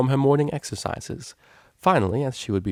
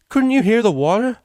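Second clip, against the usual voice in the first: indignant outburst; 13 semitones higher, 8 dB louder than usual. Acted.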